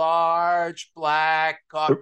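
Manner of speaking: slow, word by word; over enunciating. Shown as a demonstration. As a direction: spell it out slowly, as to someone hard of hearing. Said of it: "I say it slowly and a little more loudly".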